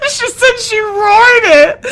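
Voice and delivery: high, loud and slow through laughter; helpless laughter. Real, not acted. Reader defending the roar to the co-reader, laughing hard. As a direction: squeal it out while cracking up. Cracking up over her own roar.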